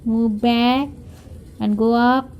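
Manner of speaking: ordinary delivery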